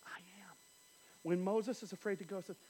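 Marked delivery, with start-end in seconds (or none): 0.1-0.6 unvoiced whisper. God whispering to fearful Moses. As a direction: breathe it out.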